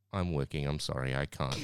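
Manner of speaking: low, reluctant apology; reluctant refusal. Retold or imitated. Worker retelling how he declined the guest, low and reluctant. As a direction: turn it down regretfully, dropping low.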